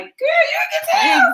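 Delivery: very high-pitched, playful squeal of words; high mock exclamation. Answering people who guess New York, high mock squeal. Acting out her reply to guessers.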